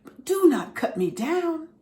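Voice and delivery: high, loud, stammering plea; terrified pleading. A narrator's reading. Bamboo begging the master not to cut him, terrified. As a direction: beg in panic not to be cut down. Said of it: "Bamboo shook in horror".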